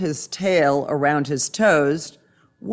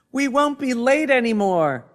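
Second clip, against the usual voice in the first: high-pitched, excited cheer; 9 semitones higher than usual. A narrator's reading. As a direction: cheer for all the farm animals. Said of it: "all the other animals cheered".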